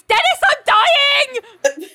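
high, loud, drawn-out mock scream; screamed outburst. Ali trying to stop Dennis laughing, screaming. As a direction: scream it melodramatically, stretching the words. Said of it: "she just screams".

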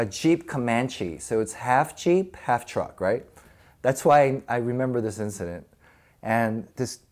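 normal talking voice

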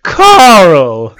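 loud, proud name call; proud shout. Acted. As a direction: bellow the fake name proudly. Announces fake name loudly.

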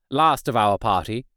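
ordinary delivery, nothing marked

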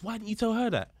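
high-pitched retold demand; mock indignation. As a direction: mimic a put-out sergeant demanding an answer. Retold indignant question from the sergeant.

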